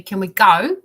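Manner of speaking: loud, insistent repeated pleading; begging child. Retold or imitated. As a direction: nag to leave like a restless kid. Son begging to leave noise.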